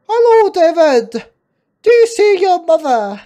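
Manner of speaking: very loud, high, drawn-out doctor voice; hammed-up doctor, projected hard. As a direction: boom a greeting in a high silly voice. Plays the doctor.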